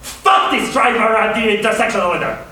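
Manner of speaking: honest burst of road rage; natural anger. Shown as a demonstration. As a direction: snap at the driver who cut you off. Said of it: "I'll be like".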